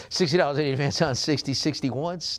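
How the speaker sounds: brisk, lifted auction patter; auctioneer's call. Acted. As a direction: rattle off the bid like an auctioneer. Auctioneer resumes calling bids.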